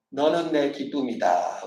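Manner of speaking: put-on slow and gentle, as if to a child; slow tender blessing. Shown as a demonstration. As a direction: model the words for the congregation. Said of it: "옆 사람하고 얘기합시다". (천천히 다정하게 축복하듯)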